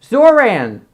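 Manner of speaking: Zoran run together as ZOR-an, high then falling; attempted pronunciation. Shown as a demonstration. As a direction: try "Zoran" loudly with no H. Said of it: "I can't pronounce it".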